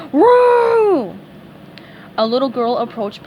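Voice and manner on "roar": high, loud mournful roar; sad moaning roar. A narrator's reading. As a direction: roar out the heartbreak. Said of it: "he moaned sadly".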